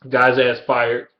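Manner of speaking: ordinary talk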